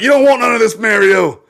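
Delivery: high, loud shouting warning. Retold or imitated. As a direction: yell it with a laugh. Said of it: "They're like".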